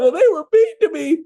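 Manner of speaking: put-on very high, babyish hurt voice; mock whining. Coach mocking agents' hurt feelings, whiny. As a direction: mimic a wounded complainer in a squeaky pitch.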